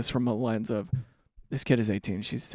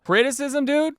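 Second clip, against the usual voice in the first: incredulous; 11 semitones higher than usual. Real, not acted. Incredulous at partner's texts.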